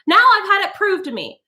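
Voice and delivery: high, righteous scolding; vindicated outrage at poetry; high accusing tirade; indignant. A narrator's reading. Mrs Bowles glared.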